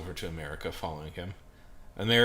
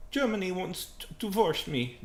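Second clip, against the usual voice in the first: sad; 12 semitones higher, 4 dB louder than usual. Acted. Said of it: "Japan notices that Italy is sad".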